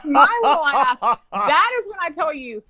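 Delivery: ordinary delivery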